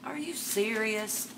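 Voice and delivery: ordinary talk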